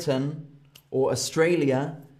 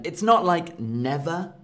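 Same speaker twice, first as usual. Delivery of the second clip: ordinary talk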